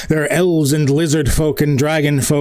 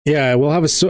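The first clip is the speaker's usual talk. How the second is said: normal talking voice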